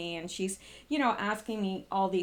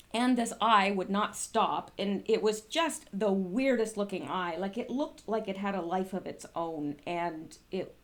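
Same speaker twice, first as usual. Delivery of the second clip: ordinary delivery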